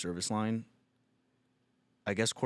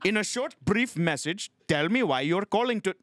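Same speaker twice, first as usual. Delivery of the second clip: put-on high-pitched Indian-accented automated prompt; robot phone agent; 7 semitones higher than usual. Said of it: "A robot".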